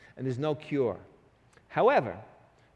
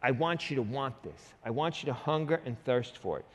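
ordinary talk, nothing marked

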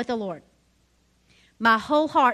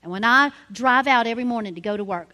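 ordinary talk

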